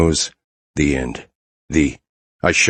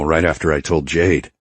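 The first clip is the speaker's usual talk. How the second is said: plain reading voice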